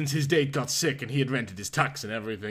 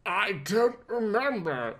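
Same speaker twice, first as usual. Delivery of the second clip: croaked; 6 semitones higher than usual. Acted. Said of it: "Bruce croaked".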